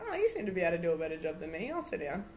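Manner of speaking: ordinary talk, nothing marked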